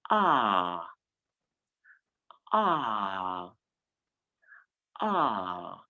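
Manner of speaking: drawn-out open ah, held; long ah sound. Shown as a demonstration. Teacher showing the fifth vowel, long ah. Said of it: "this one is pronounced".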